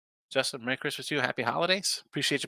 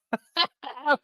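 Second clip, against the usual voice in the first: high laugh; 12 semitones higher than usual. Retold or imitated. Lead-in to impression.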